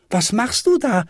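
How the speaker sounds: put-on high-pitched child's question; high, lightly called out; calling up. A narrator's reading. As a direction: call up into a tree, without really shouting. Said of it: "rief der kleine Häwelmann hinauf".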